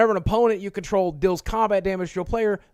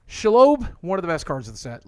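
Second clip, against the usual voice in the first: usual speaking voice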